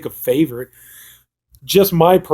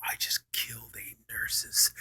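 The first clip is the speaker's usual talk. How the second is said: slow, creepy stage whisper; hushed, drawn-out imitation of a whisper; 3 semitones lower, 13 dB quieter, slower than usual. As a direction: whisper it slowly, like a confession. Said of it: "he, like, whispers to her".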